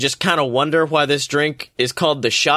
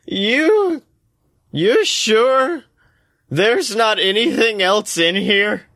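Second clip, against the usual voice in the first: put-on high, slow drunken stammer; drunk and suspicious; 6 semitones higher, slower than usual. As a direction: drunk and losing it. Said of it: "Dee hiccups".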